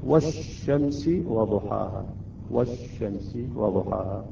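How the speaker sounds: "والشمس وضحاها" read wash-shamsi, l dropped and sh held; lam fully assimilated. Shown as a demonstration. Teacher reading the verse correctly, "والشمس" as wash-shamsi without lam. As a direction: read "والشمس" with silent l and doubled sh. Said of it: "فتصبح القراءة".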